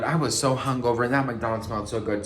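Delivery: ordinary talk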